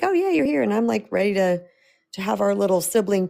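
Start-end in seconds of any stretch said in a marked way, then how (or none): 0.0-0.6 excited greeting. Retold or imitated.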